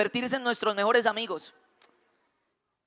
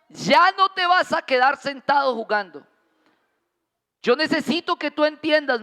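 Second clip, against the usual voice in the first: higher and louder, firm fatherly lesson; dad setting a rule, firm and raised; stern father; 6 semitones higher, 4 dB louder than usual. Retold or imitated. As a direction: lay down the law to your son. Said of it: "Y me dijo".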